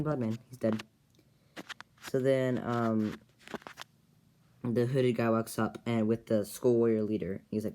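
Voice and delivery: ordinary delivery, nothing marked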